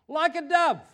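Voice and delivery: put-on high, loud childlike piping; high loud imitation of a child; child's answer. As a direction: squeak it out like an eager kid. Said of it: "He would have gone".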